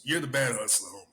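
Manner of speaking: normal talking voice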